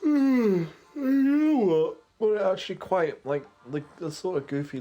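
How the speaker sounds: high comic impression of the absent Aris; imitating Aris. Wondering what Aris would say, a high mock voice. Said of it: "I wonder what he would say".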